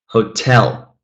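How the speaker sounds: "hotel" with the weight on TEL; stress on second syllable. Shown as a demonstration. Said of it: "let's stress the second syllable".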